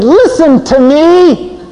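slow, high and loud command; wife demanding. Retold or imitated. Wife turning off the TV, loud slow demand. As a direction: make him stop and listen. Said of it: "And she said".

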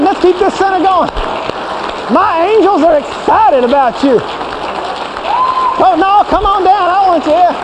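high, eager cheer to come on down; rally the angels to cheer him home; excited cheering. Acted. God cheering sinner home.

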